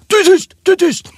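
very high, loud barked order; furious command. Retold or imitated. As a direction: silence her with a yell. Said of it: "Så här".

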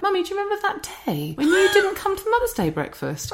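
put-on high-pitched little kid voice; young child. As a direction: imitate a small child asking Mummy innocently. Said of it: "playing in the garden one day".

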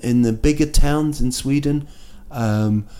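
ordinary talk

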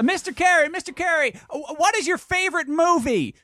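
high-pitched reporter calling out; eager reporter. Acted. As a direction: call for the politician's attention, pitched high. Reporter calls out to politician.

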